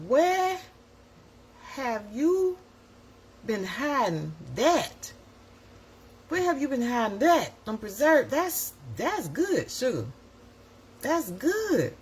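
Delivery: high, delighted praise; mother's delight. Retold or imitated. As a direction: gush over the taste. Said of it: "It's like".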